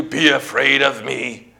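slow, drawn-out threat; menacing devil. Acted. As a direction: draw it out like an intimidating enemy. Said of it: "Goliath or the devil says to you".

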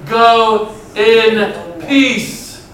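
"Go in peace" drawn out very slowly; slow, solemn. Acted. Pastor giving Jesus' words to the congregation, slow and solemn. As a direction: let each word land slowly, like a blessing.

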